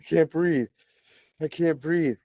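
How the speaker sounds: mock panicked child; imitating panicked son. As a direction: play the scared kid gasping he can't breathe. Son panicking, voiced by parent.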